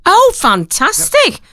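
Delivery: high, slow delighted oh fantastic; excited. Real, not acted. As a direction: gush over the good news. Delighted at ticket giveaway.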